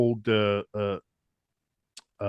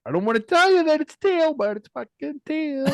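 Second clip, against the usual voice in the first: mock high-pitched. Fan settling an argument over the team color, mock falsetto. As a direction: squeal it in exaggerated falsetto, stretching the last word.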